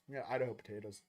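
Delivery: normal talking voice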